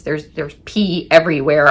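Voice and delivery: ordinary talk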